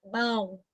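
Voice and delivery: Mão said softly, sounding through the nose; a soft nasal Mão; quiet, nasal Mão; nasal demo. Shown as a demonstration. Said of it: "Vamos pegar a palavra mão".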